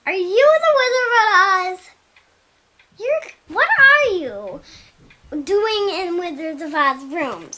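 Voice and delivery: mildly indignant; Darla questioning. Retold or imitated. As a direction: challenge the stranger in the room. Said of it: "she was like".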